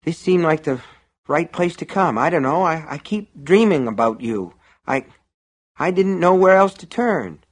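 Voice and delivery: high, faltering plea for help; distressed. A narrator's reading. Troubled customer confiding in shopkeeper, distressed.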